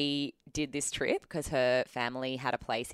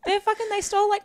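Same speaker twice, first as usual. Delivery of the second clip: indignant outburst; 8 dB louder, faster than usual. Real, not acted. Heated defense of convicts.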